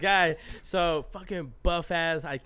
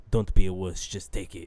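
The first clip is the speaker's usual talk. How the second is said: lower, firm retold doctor; lightly voiced doctor; 9 semitones lower, 6 dB louder than usual. Doctor to a patient mid covid test, lightly imitated low voice. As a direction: give the doctor's order in a lower voice. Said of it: "And he's like".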